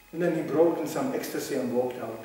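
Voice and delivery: normal talking voice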